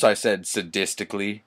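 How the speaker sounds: usual speaking voice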